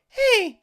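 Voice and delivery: imitating a high startled squeak; squeaky yelp. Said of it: "and then he's like".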